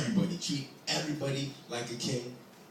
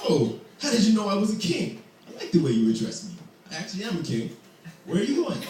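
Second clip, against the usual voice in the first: higher, louder delighted king; a flattered king; pleased king; 6 semitones higher, 5 dB louder than usual. Acted. King flattered by the boy's greeting, delighted.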